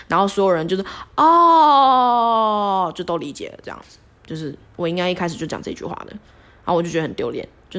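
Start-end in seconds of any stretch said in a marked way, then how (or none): 1.2-2.9 long, loud, rising "哦" of sudden understanding; drawn-out oh of understanding. Retold or imitated. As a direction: let it dawn on everyone at once. 所有人恍然大悟. (恍然大悟地拉长一声"哦")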